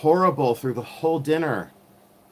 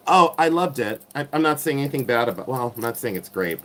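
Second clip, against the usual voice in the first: normal talking voice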